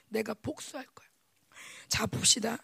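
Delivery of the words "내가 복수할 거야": soft menacing whisper; whispered vow. Retold or imitated. God vowing revenge on Babylon, in a whisper. God's vow of vengeance voiced.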